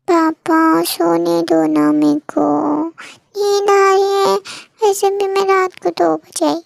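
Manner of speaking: slow, drowsy begging; sleepy plea. Acted. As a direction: stretch it out, still half asleep. Sleepy daughter begs to sleep. (नींद में धीमी मिन्नत)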